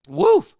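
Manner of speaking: high loud gasp of relief; woof. Real, not acted. Streamer recovering from the chug, loud woof. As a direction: blow it out hard.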